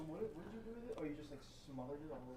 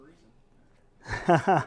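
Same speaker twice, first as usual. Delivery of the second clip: laughing; 22 dB louder than usual. Real, not acted. Joking complaint while laughing.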